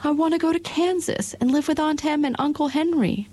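put-on high young girl's protest; Dorothy crying out. A narrator's reading. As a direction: cry out like a small girl who won't stay. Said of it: "cried Dorothy".

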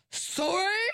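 high, outraged echo of the word; mock the apology with outrage; furious screech. A narrator's reading. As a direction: Perdita screeching behind Ringlet, high and outraged. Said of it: "a furious voice screeched".

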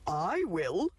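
a hint of a grunting pig; lightly grunted pig answer. A narrator's reading. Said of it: "grunted the pig".

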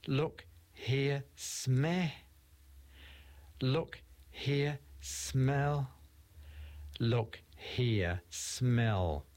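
each line spaced with a pause; sound poetry. Acted. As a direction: build the words up sound by sound. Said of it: "very much a sound poem as well".

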